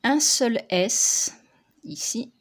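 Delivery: ordinary talk, nothing marked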